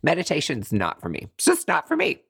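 mock huffy giving up, pitch jumping high; acted-out frustrated refusal. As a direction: declare you quit, voice popping up in places. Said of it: "So I get frustrated".

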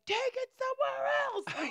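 high mock holler out the window; yelling out the window. Narrator hollering at the couple from the window, high. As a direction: call it down to the street. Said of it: "stuck my head out the window and I was like".

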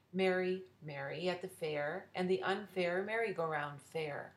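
ordinary delivery

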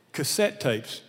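usual speaking voice

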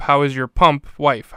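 "pump" punched loud before "wife", slow; slow, loud "How is your pump wife?" with pump stressed. Shown as a demonstration. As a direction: hit "pump" hard, then "wife". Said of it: "figuring out where to put the pause".

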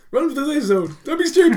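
loud, high-pitched shouted order; in-character yell. Acted. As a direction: yell it urgently to a teammate at full volume. Player yells orders in character.

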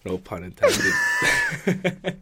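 high laughing aside. Real, not acted. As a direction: laugh out the words.

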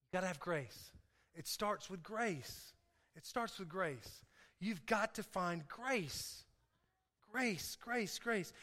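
soft, low, slowed preaching; hushed earnest. Real, not acted. Preacher urges church to find grace, hushed and earnest. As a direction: bring it right down after the climax.

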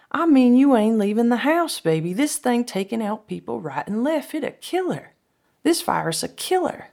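high, urgent, loud warning; worked-up insistence in a high voice; alarmed warning. Retold or imitated. Frank warns the virus kills.

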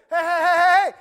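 loud, high urgent calls down the hall; calling out. Acted. Paul and Silas calling down the hall to the jailer, loud urgent shout. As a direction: call out to stop him.

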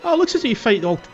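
ordinary delivery

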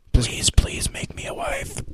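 high, slow whispered prayer; whispered plea. Pretend. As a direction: beg in a breathy whisper. Joking prayer to Andrew.

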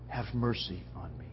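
very soft, low begging; humble quiet plea. Acted. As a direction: plead gently, barely audible. Quiet take of the beggar's plea.

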